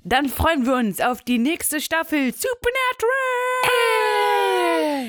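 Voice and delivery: slow, high hammed-up announcer. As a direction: stretch it out like a show host. Hammed-up show outro announcement.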